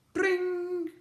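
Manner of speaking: high loud bike sound effect; bike noise. A narrator's reading. Narrator voicing the slime bike's engine.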